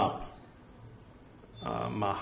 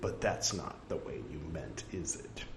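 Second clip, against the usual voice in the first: soft, low, understated wry remark; gentle teasing with a lightly tagged question; dryly humorous; 7 semitones lower, 10 dB quieter than usual. Acted. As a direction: say it as a quiet joke. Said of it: "That struck me as humor".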